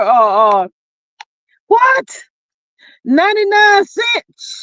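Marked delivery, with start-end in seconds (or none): 1.7-4.6 shocked disbelief. Real, not acted. Reacting to the ninety-nine cent roses, a high shocked cry.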